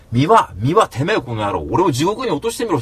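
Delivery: loud, heated taunt; reliving a rant at Miwa; retold furious challenge. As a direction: dare him angrily. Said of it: "勝手に怒ってんのよ".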